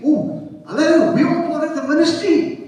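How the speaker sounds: high, rousing pulpit cry; full-force preaching. Real, not acted. Preacher telling congregation they're in the ministry, full-force preaching.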